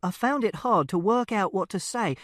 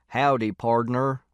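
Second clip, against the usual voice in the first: slow mock Texan howdy; jokey cowboy drawl; 4 semitones lower, 4 dB louder, slower than usual. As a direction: play a dad doing a cowboy joke. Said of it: "which is a joke he does".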